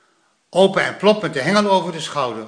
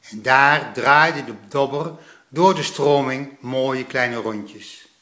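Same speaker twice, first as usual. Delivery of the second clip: plain reading voice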